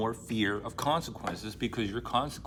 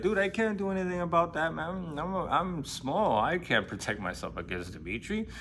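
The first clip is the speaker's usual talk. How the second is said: much higher, pleading put-on voice; whiny small guy; 6 semitones higher than usual. Smerdyakov excusing himself to Ivan in a high whiny voice. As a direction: plead weakness like a timid little man. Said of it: "He's like".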